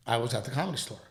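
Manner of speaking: ordinary delivery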